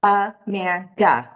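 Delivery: Amanda said slowly, one beat per syllable; slow clapped syllables. Shown as a demonstration. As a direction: clap out each syllable of Amanda. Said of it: "I'm going to say Amanda and clap".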